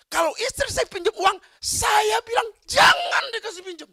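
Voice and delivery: high, loud mock order not to lend; husband forbidding loans, half-acted shout; husband's shouted order. Preacher voicing the husband's order to the rich, shouted. Husband voiced in sermon story.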